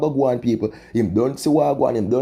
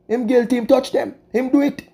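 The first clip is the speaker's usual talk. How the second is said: much higher, squeezed accusing voice; pitched-up verdict; 13 semitones higher than usual. Real, not acted. Vlogger to viewers, high-pitched accusing verdict.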